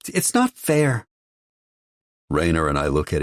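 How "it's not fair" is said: put-on small, much higher boy's voice, slow; a small boy voicing a grievance, high and slow. A narrator's reading. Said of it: "He says in his small voice".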